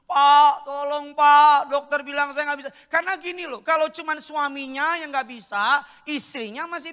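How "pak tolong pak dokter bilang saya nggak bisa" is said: mock tearful plea, loud and high; loud, imitated crying plea; imitating sobbing plea. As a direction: act out a wife weeping and begging. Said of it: "Nangis, nangis kejer".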